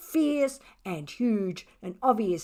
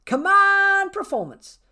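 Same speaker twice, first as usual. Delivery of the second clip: louder, higher cheering cat; Bella's voice; 8 semitones higher, 8 dB louder than usual. Acted. Bella urging Tuffy on, loud and high. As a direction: egg him on, loud and high. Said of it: "agreed Bella".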